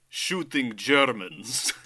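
deadpan slow. Retold or imitated. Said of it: "And his response".

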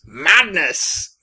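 normal talking voice

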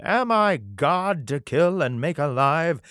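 a king rending his clothes, slowing each word; distraught king, slow and loud. Acted. King of Israel reading the letter, anguished and slow. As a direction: stretch it out in anguish. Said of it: "he rent his clothes and said".

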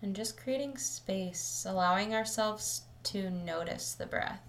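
calm, measured meditation voice with long pauses; settled, even delivery, leaving space between phrases; guided meditation. Acted. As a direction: guide listeners gently into the breath, unhurried.

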